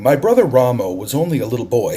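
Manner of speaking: plain reading voice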